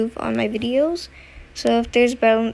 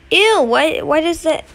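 loud, high disgusted ew; 5 semitones higher, 6 dB louder than usual. Real, not acted. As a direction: recoil in disgust. Gross flooded restroom.

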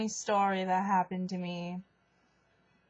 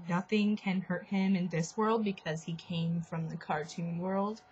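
usual speaking voice

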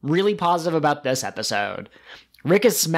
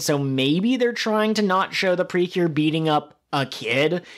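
ordinary talk

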